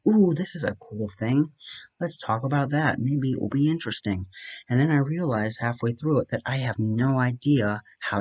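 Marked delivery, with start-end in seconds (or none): 0.1-4.3 higher-pitched, acted-out enthusiasm; excited discovery. Rambler stumbling on a cool topic, mock excited. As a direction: act like a new idea just grabbed you. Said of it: "until I'm like".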